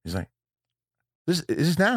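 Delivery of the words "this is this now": imitated Aussie guest, higher-pitched; Australian accent. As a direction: ask in a put-on Australian accent. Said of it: "always wants to do the Australian accent".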